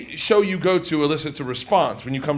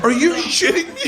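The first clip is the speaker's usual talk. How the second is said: disbelief; 10 semitones higher than usual. Retold or imitated. Acted disbelief.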